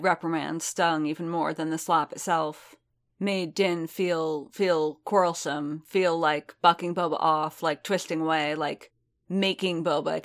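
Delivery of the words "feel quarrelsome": plain reading voice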